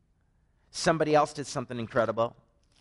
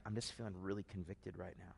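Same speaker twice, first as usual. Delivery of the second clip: much softer, lower and inward; hushed confession; 5 semitones lower, 19 dB quieter than usual. Retold or imitated. Husband answering his wife, soft and low. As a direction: murmur it like a deep thought. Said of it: "And I'm like".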